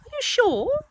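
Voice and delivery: high, loud, surprised question. Acted. Rabbit questioning Pooh's identity, surprised. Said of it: "still more surprised".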